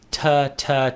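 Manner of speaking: crisp "ta, ta" from the tongue tip; tonguing syllables. Shown as a demonstration. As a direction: tap out "ta, ta" like tonguing. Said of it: "ta is a different sound versus ga".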